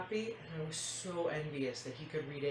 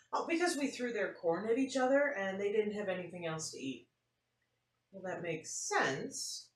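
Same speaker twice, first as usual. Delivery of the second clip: lively storybook read-aloud; animated picture-book reading; reading to children; 4 semitones higher, 7 dB louder than usual. A narrator's reading. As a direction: tell it like story time for kids.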